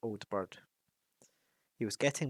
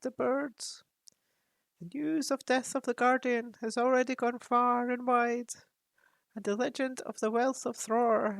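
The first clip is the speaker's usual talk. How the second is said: higher, grave raven voice; counselling raven; 13 semitones higher, 3 dB louder than usual. Acted. Raven Roak counsels Thorin about coming danger. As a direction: warn him like an ancient advisor.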